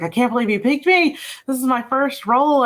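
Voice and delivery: high-pitched imitation of a nervous newcomer; a little scared. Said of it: "she was a little scared".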